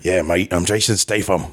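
put-on British tough-guy accent; casual British action-star voice; Jason Statham. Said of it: "how was his accent".

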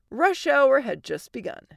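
usual speaking voice

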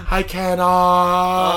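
very slow, drawn-out mock Japanese accent; deliberately terrible Japanese accent; bad Japanese accent. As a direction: stretch it out in a clumsy Japanese accent. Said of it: "did I just do the worst Japanese accent".

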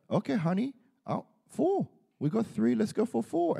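eager plea to wife. Retold or imitated. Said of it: "I was like".

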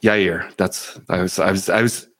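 normal talking voice

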